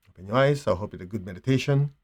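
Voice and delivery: ordinary talk, nothing marked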